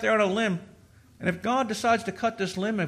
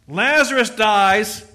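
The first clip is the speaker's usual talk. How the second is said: usual speaking voice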